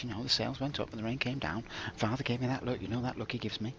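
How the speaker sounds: pompous storybook narration; melodramatic narration. Pretend. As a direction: spin a mock-tragic tale.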